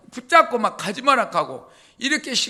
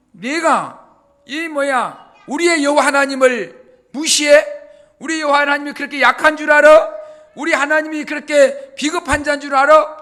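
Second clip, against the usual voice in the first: loud, higher, rushing indignation; indignant outcry; 3 semitones higher, 6 dB louder than usual. Retold or imitated. David angrily rebuking Goliath for mocking God, loud and quick. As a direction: fire back in righteous anger. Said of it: "이 다윗이".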